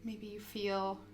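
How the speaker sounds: soft, unhurried yoga guidance with long pauses; guided meditation. Acted. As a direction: gently guide them into stillness, phrase by phrase.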